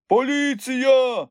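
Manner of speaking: high, loud, drawn-out alarm call; sudden cry. A narrator's reading. Someone warning the station crowd, sudden cry. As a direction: shout it out as a warning, stretching it. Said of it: "раптом пролунав крик".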